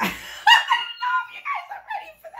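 high squeal through laughter; giggly high repeat; laughing. Real, not acted. Laughing at her own remark.

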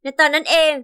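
ordinary delivery, nothing marked

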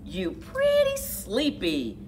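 lively picture-book read-aloud; reading to children. A narrator's reading. Narrator reading a kids' exercise book, lively. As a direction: read it like a storybook for kids.

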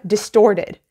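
ordinary talk

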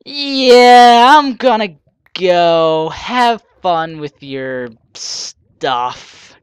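slow, deadpan goodbye; bored brush-off. Retold or imitated. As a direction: drag it out, unimpressed. Imagined viewer leaving the channel.

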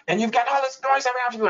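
high, fast babble of noise; mimicked hubbub. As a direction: rattle it off like a noisy crowd. Acts out overwhelming daycare noise.

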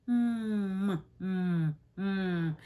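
humming "mm" softly, lips closed; m sound. Shown as a demonstration. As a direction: hold a soft "mm" hum. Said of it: "M makes the sound".

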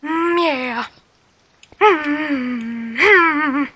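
high whiny growling mm sounds; angry chihuahua noise. Pretend. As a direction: yap and whine like a tiny angry dog. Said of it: "you sound like an angry chihuahua".

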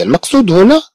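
ordinary talk

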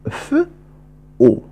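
ف then أو, each sound separately; sounds apart. Shown as a demonstration. Teacher separating sounds: ف, then أو. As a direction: split it: ف, pause, أو. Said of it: "أنطق الصوت الأول ثم الصوت الذي يليه".

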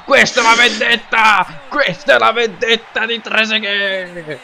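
shouted like a goal commentator; goal commentary shout. Real, not acted. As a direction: yell it as Italian goal call. Celebrating revenge goal.

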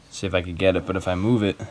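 usual speaking voice

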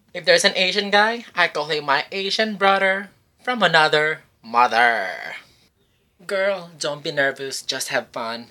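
ordinary talk, nothing marked

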